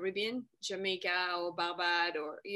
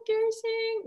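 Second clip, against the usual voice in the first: high-pitched, drawn-out confusion; puzzled, high mock question; baffled; 12 semitones higher, much slower than usual. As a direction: act out being totally lost. Imagines being lost in Texas.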